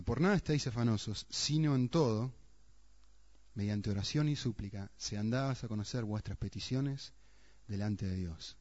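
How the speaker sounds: scripture reading. Acted. Preacher reads Paul's words to the congregation, solemn reading.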